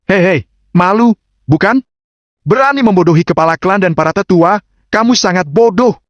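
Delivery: high-pitched sneering taunt; Lawrence. A narrator's reading. Rival jeers at Agam for fooling the clan elders, sneering. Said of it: "melihat Agam dan mencibir".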